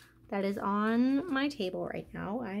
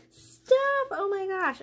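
much higher, louder squealed word; excited squeal; 8 semitones higher, 4 dB louder, slower than usual. Real, not acted. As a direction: squeak it with delight. Overwhelmed by gifts.

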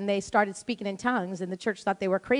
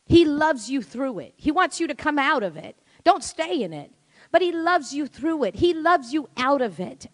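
rising, louder preaching; 4 semitones higher, 5 dB louder than usual. Acted. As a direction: build the altar-call fervor. Sermon climax.

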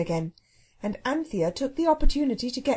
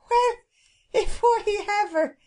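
high, slow exclamation of amazement; flabbergasted cook; 11 semitones higher, 5 dB louder than usual. Acted. Cook seeing that a bird has spoken, flabbergasted. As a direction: draw it out high in sheer disbelief.

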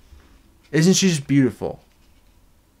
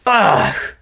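loud high groan of disgust; disgusted ugh; 6 semitones higher, 4 dB louder than usual. Real, not acted. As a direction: groan at the ugly sticker. Disgust at stickers.

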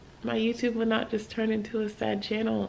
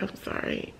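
soft breathy whisper; barely voiced, drained apology; 8 dB quieter than usual. Tired friend apologising to someone asking for prayer, whispering. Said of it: "I'd be like".

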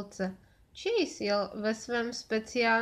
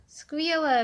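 higher, louder delighted exclamation; excited cry; 5 semitones higher, 5 dB louder than usual. A narrator's reading. Alex hears his tricycle is fixed, excited cry. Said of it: "vykřikl Alex".

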